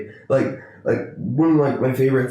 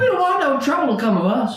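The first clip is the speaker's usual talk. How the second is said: put-on voice; 12 semitones higher than usual. Put-on voice about PG thirteen.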